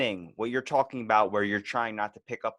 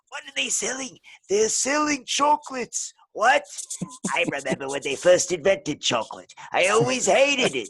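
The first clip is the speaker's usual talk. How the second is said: put-on old woman; 10 semitones higher, 5 dB louder than usual. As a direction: stretch it out like a deaf, ancient woman. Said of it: "the old woman in the retirement home".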